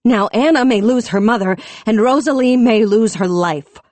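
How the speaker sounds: high loud accusing tirade; furious tirade. A narrator's reading. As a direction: blame them all at full voice. Raging at the chief.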